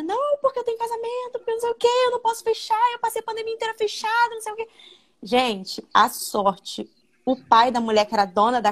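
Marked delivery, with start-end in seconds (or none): 0.0-4.7 high, pleading imitation of a panicking bride; desperate woman. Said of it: "a mulher desesperada". (imitando a noiva desesperada, voz aguda e suplicante)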